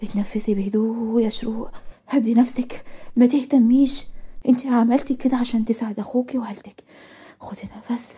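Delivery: soft, hushed inner pep talk; self-calming. Acted. Girl steadying her nerves before a delivery, hushed and calming. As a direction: soothe yourself quietly, sinking to a whisper. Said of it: "بدات تتنفس وتحاول تتحكم في اعصابها".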